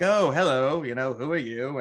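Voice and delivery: a hint of a British officer saying hello; British accent. First Order officer greets the family in the bar, light British accent. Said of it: "with the typical British accent".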